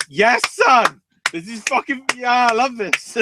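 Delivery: high, loud mock cheering fan. Imitating a ramp fan at the arena, high loud cheering. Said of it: "literally just going".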